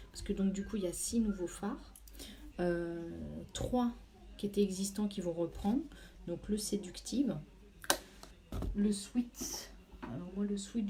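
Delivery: normal talking voice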